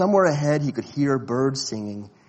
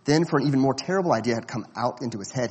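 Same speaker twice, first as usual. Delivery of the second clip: ordinary delivery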